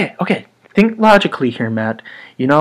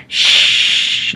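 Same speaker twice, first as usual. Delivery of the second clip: librarian shush. Retold or imitated. Imitating the Ghostbusters librarian ghost shushing. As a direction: hush them like a strict librarian. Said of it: "she's like".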